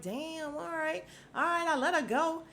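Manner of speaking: high-pitched, hurried giving in; mock panicked surrender. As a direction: imitate a man backing down at gunpoint. Man giving in at gunpoint.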